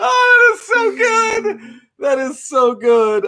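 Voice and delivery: high-pitched, drawn-out delighted cry; real delight. Real, not acted. Host reacts to co-host's accent countdown, delighted. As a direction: squeal with delight, easing on the repeat.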